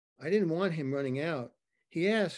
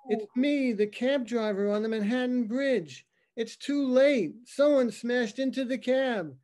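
higher, louder, urgent and rattled; panicked caller; 7 semitones higher, 5 dB louder than usual. Acted. Cab driver calling nine one one, panicked and loud. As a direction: blurt it out like a scared witness on the phone.

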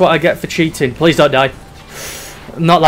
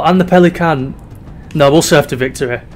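ordinary talk, nothing marked

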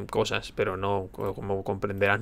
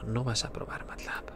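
whispering; 11 dB quieter than usual. Acted. Teasing aside to friend.

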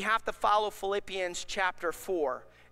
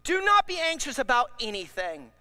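ordinary talk

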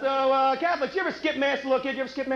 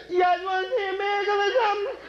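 high party show-off voice; Jesus showing off; 9 semitones higher than usual. Pretend. Jesus to party guests, high show-off bravado. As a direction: hype up the crowd by the pool. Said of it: "I doubt if he was ever at a party, though, going".

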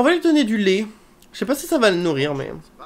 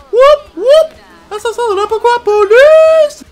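high, loud whooping shout; party yelling; 12 dB louder, slower than usual. Acted. Sim shouts lyrics at the party, loud. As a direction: scream along to the music. Sim yells over music.

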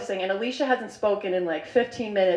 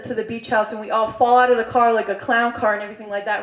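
usual speaking voice

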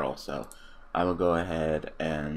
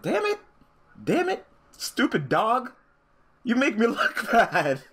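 high-pitched, hammed-up scolding; slow, exaggerated playful telling-off; mock tantrum; 4 dB louder than usual. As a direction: squeal in pretend fury, breaking into laughter. Playful mock scolding, breaking into laughter.

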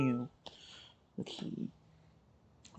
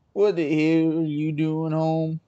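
slow, loud, no deep tone yet; first try at the mother, too light; wrong mom voice; 5 dB louder, slower than usual. Acted. Mother catching daughter at home, slow loud try. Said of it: "she had the deep voice".

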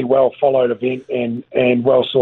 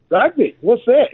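puzzled local; 9 semitones higher than usual. Retold or imitated. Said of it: "And you're like".

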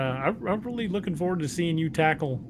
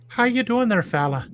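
higher-pitched Belfast greeting with soft lilt; Belfast accent; 6 semitones higher than usual. Shown as a demonstration. Explaining Irish accents with a sample greeting, Belfast lilt. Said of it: "a softer lilt at the end".

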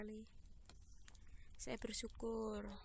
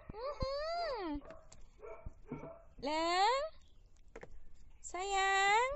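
high cat-calling voice; 8 dB louder, much slower than usual. Real, not acted. Calling the cats for food.

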